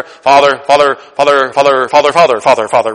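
a hint of a droning pray-er, Father over and over; lightly mock the rambling prayer habit; mocking repetitive prayer. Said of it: "prayers we always make fun of".

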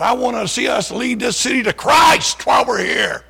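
preacher stirring up his Bible study; fiery preaching. Retold or imitated. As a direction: rouse the room like a young preacher. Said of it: "And he said".

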